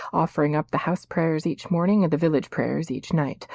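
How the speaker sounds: ordinary delivery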